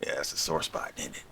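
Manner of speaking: soft breathy teasing aside; whispered jab. Real, not acted. Reactor whispering a jab at the British, teasing aside. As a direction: lean in and needle them under your breath.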